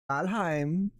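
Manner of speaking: put-on high, drawn-out German-accented call; movie German accent. Papa asking where Valheim is, high mock German accent. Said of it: "movie version of a, of a German accent".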